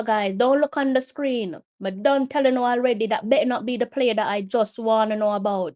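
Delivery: ordinary delivery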